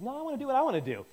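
put-on quick, high, childish retort; petulant retort. Michael refusing to stop dancing at a funeral, petulant and quick. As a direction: fire it back fast like a stubborn kid.

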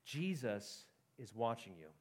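quiet, slow, ominous warning; low-key eerie announcement; voice in the dark. A narrator's reading. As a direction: draw it out softly from the shadows. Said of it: "he heard a voice say".

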